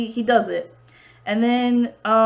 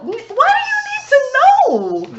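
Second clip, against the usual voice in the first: suspicious retort; 5 dB louder than usual. Retold or imitated. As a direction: push back, wary and put out. Suspicious reply to stranger.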